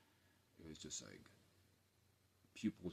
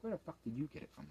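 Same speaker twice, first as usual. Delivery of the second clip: mock disbelief; 10 semitones higher than usual. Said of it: "He's like".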